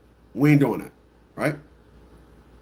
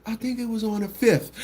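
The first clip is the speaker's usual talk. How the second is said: soft quote; 4 semitones higher, 7 dB quieter than usual. Retold or imitated. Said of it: "I would hear her say".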